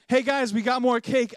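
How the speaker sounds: high, loud imitated announcement; excited friend calling out. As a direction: shout the good news to the whole party. Friend calls out more cake.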